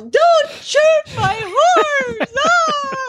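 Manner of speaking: very high, drawn-out mock wail; hammed-up tearful plea of a little girl; melodramatic screaming girl. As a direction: wail it slowly, imitating a hysterical child. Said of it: "melodramatic as you can imagine".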